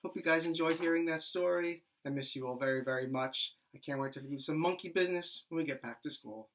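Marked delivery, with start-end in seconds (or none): none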